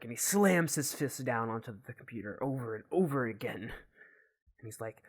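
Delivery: ordinary delivery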